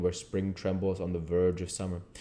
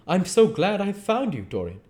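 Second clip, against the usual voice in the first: a hint of a grave Basil, raised pitch; 12 semitones higher, 4 dB louder than usual. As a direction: speak gravely, pitched above the narrator. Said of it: "he said gravely".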